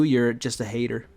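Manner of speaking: ordinary talk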